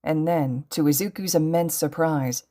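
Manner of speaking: ordinary delivery, nothing marked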